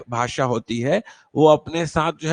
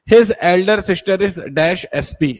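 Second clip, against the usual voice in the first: slow and louder; slow dictation; 5 dB louder, much slower than usual. Shown as a demonstration. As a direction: read it out slowly for students. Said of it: "teacher reads exercise slowly". (धीमी, ज़ोरदार पढ़ाई)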